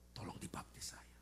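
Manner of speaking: whispered, soft request; hushed plea. Retold or imitated. Man quietly begs pastor for baptism, whispered. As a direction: whisper it humbly.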